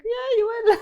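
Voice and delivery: very high, laughing mock agreement; retold playful assurance. Teasing someone about seeing leprechauns, laughing mock assurance. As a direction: tease with a sky-high, giggly yes. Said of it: "And I was like".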